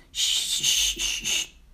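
long voiceless hisses; hissing flames imitation. A narrator's reading. Narrator voices flames meeting water, sharp hissing. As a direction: imitate fire being doused. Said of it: "deden de vlammetjes".

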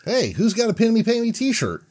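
high, ringing call to the room. Pretend. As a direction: call it out like a ringmaster. Calling out to the room.

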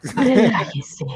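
slow soothing receptionist voice; calm, drawn-out spa greeting. Pretend. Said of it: "Me encantó la voz de la secretaria".

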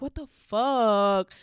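slow, loud, stretched-out disbelief. Retold or imitated. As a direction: drag it out in shock. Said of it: "I was like".

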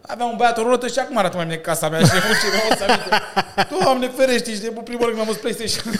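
high, loud, words breaking into laughter; laughing while talking. Real, not acted. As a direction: crack up as you tell it. Cracks up telling the trailer story. (povestește râzând în hohote)

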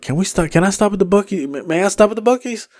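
high, soft mock-polite request; polite pleading. Passenger asks driver for a food stop, politely pleading.

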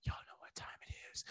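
hushed teasing whisper. Acted. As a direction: breathe it out as a secret. Whispered aside before DJ drop.

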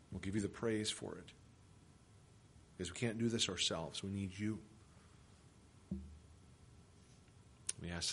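low, soft, unhurried prayer voice; quiet prayer. Acted. Pastor praying for the congregation before the sermon, soft and low. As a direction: pray gently on behalf of everyone.

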